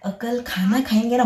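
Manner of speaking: ordinary talk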